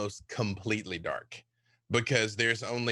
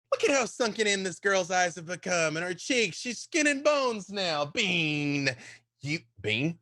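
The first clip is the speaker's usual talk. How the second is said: put-on high, fretting mother's voice over a skinny child; fussing mother; 5 semitones higher than usual. Mother fussing over Bean's thin face, high and worried. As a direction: coo and fret over her thin daughter, pitched up. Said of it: "And she's like".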